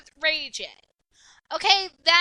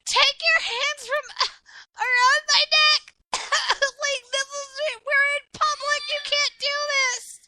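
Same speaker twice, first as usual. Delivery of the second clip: much higher, louder put-on voice, laughing through a mock shout; hammed-up choking victim yelling between laughs; mock panicked plea; 9 semitones higher, 4 dB louder than usual. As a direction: squeal for help like you're being strangled in public. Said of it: "Be like".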